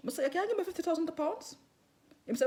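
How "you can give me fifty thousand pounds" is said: joking. Retold or imitated. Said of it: "In a joke".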